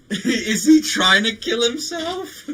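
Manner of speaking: incredulous, drawn out. Retold or imitated. Recalling his stunned reaction, slow incredulous outburst. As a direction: stretch out the shock of it. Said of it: "I'm like".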